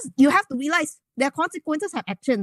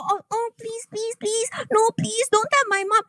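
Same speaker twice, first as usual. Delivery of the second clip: put-on pitched up, whiny begging like a scared kid; mock her as a child pleading; a hint of a whimpering child, higher; mocking childish plea; 8 semitones higher than usual. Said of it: "you sound like a child".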